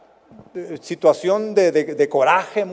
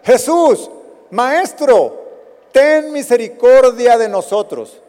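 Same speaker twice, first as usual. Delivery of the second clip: pleading cry; 5 semitones higher, 6 dB louder, slower than usual. Retold or imitated. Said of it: "y gritaron".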